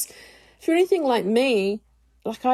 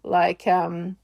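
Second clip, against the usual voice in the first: normal talking voice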